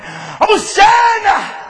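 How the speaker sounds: long, high, slow shouted Hosanna; children crying out Hosanna in the temple. Acted. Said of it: "they're crying".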